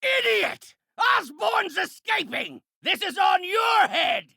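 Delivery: furious villain's yell; enraged shout. Acted. Villain blaming Peter as his enemy escapes, enraged shout. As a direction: roar the blame at them.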